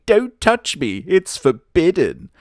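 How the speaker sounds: prissy mock. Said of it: "is basically".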